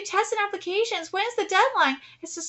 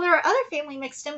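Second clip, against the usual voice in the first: ordinary delivery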